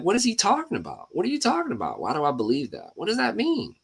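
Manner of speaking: high, mocking voice of an annoyed viewer; mock pissed listener. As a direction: imitate a confused, irritated listener. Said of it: "make a couple people pissed".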